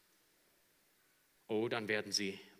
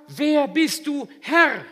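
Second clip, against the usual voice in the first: loud, much higher, slow cry; awed question; 20 dB louder, slower than usual. Acted. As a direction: cry up in awe. Humbled Saul asks. (lauter ehrfürchtiger Ruf)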